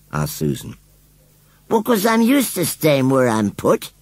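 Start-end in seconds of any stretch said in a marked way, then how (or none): 1.7-3.9 high, slow and resigned; forlorn. Acted. Scarecrow tells Susan why he stays, forlornly. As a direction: say it like a thing left where it was put. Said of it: "replied Gummidge forlornly".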